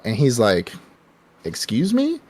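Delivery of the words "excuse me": high, indignant pitch jump; offended surprise. Retold or imitated. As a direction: act scandalized at the insult. Villain offended at comparison.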